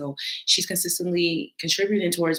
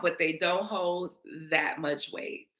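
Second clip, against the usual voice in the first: ordinary delivery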